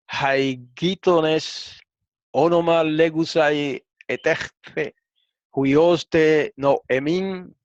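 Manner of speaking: slow, deliberate Greek read-aloud. Shown as a demonstration. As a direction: read each Greek word slowly for learners. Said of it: "Pero vamos a leerlo de acá". (lectura pausada del texto griego)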